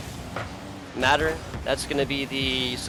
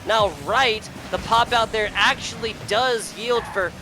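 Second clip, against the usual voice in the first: raised-pitch running commentary; live play-by-play; 5 semitones higher than usual. Real, not acted. Caster tracking Stuff's bounce attempts for viewers, live play-by-play. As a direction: follow the ball keenly, touch by touch.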